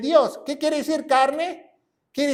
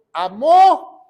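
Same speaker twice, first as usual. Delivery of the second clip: "amó" with the final ó punched louder; stress on final O; 4 semitones higher, 9 dB louder than usual. Shown as a demonstration. Preacher showing the past tense of the verse, "amó" stressed on the ó. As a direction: hit and hold the last vowel of "amó". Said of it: "con acento en la O".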